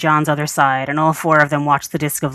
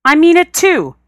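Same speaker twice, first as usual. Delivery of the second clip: much higher, louder petulant whine; whiny insistence; 13 semitones higher, 5 dB louder than usual. A narrator's reading. Rodney insisting to John he is really hurt. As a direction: sulk it out indignantly.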